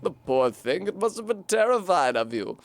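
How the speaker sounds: a kindly-sounding but scheming mystic offering a gift; British snail shaman. Acted. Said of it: "I'm British, apparently".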